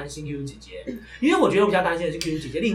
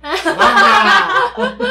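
mock threat laugh; 10 semitones higher, 9 dB louder, much slower than usual. Mock revenge boast.

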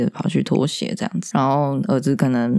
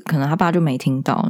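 usual speaking voice

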